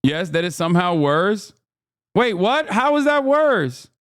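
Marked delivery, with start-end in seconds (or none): 2.1-3.9 high, disbelieving wait what; incredulous streamer outburst; surprised outrage. Real, not acted. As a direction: protest in shock, pitched way up. Indignant at chat verdict.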